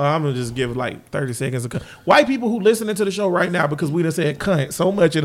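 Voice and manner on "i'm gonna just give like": normal talking voice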